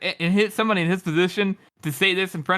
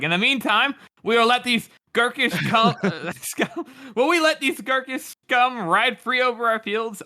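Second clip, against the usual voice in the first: rallying; 6 semitones higher than usual. Acted. King rallying speech.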